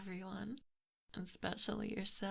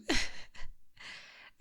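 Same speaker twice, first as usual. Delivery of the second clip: gloating breathy chuckle. Acted. Gloating at the prince.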